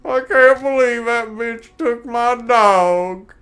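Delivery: slow, high, wailing and laughing; weepy bar drunk, hammed up; sad drunk voice. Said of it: "a drunk old sad guy at a bar".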